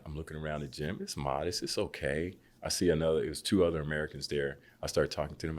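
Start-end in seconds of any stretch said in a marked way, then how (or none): none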